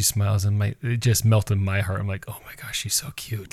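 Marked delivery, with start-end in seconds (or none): 2.3-3.5 breathy whisper of delight, barely voiced; gushing whisper. Father whispering his delight over a baby ultrasound video. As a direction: whisper it as if melting over something cute.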